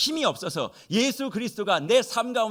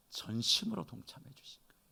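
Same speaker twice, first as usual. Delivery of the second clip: whisper; 4 semitones lower, 12 dB quieter than usual. Real, not acted. Tender point in the sermon.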